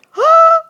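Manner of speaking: loud, high startled gasp; sharp gasp. Retold or imitated. Medium retelling her shock at the client's words, loud gasp. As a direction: gasp as if the penny just dropped. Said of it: "And I went".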